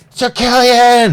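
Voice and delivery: very high, loud, slow cry of joy; light up and shout his name; guard captain Hostelon spots Sir Killian, overjoyed cry; delighted greeting. Acted. Guard greets old friend.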